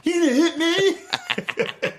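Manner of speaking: high, drawn-out protest; mock defiant wail. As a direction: whine it slowly at dad, half laughing. Retold drunk son confronts father.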